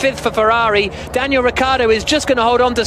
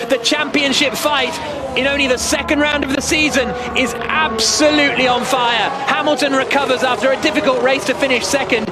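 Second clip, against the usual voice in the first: raised, driving finish-line commentary; live race-finish call; 4 semitones higher than usual. Acted. Commentator calling the winner across the line, excited. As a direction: call the win over the line with lift.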